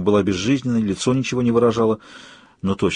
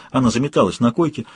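ordinary delivery, nothing marked

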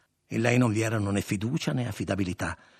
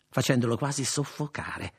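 ordinary delivery, nothing marked

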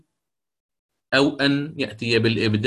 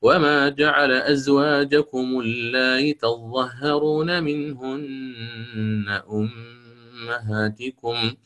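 slow chanted tajwid recitation; تلاوة مجوّدة; Quranic recitation; slower than usual. Shown as a demonstration. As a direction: recite it melodically, with eased hamza. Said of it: "فأعيد الأوجه".

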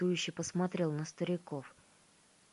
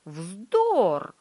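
loud outraged cry; indignant shout; 2 semitones higher, 9 dB louder than usual. A narrator's reading. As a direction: bark it out indignantly. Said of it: "возмущённо затопал ногами принц".

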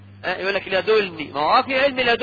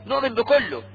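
normal talking voice

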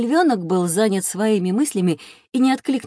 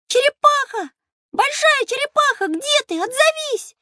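calling out; 3 dB louder than usual. Acted. Lion cub calling for turtle.

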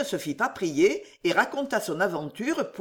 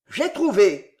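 loud, triumphant burst, short of a full roar; a narrator's contained roar; roared exclamation; 3 semitones higher, 7 dB louder than usual. A narrator's reading. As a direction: burst out with the discovery. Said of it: "rugit le vieux Pardaillan".

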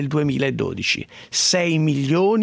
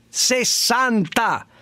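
"Sessanta" drawn out slowly, high and indignant; stress on Sessanta; 5 semitones higher, much slower than usual. Acted. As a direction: stretch out "Sessanta" in disbelief. Outraged emphasis on a figure. ("Sessanta" scandito lentamente, acuto e indignato)

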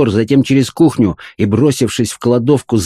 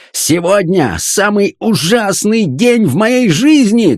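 slow, high-pitched outraged wail; indignant shouting; 10 semitones higher, slower than usual. A narrator's reading. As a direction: stretch out every grievance in outrage. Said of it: "кричал фон Хартман, топая ногами".

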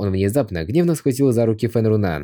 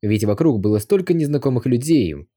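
ordinary delivery

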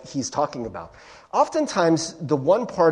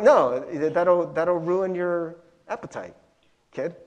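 normal talking voice

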